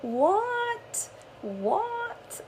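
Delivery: high, bewildered double take; sudden confusion at herself; mock shocked disbelief; surprised. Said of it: "And I'm like".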